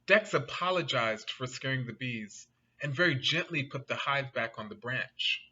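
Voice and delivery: ordinary delivery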